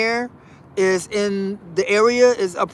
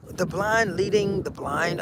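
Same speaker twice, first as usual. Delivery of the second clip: puzzled echo; 2 semitones lower, 7 dB quieter than usual. Retold or imitated. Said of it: "kid echoes quietly".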